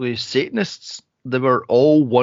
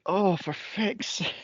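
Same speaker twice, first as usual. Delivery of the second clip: exasperated; 5 semitones higher, 7 dB quieter, slower than usual. Retold or imitated. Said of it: "I was like".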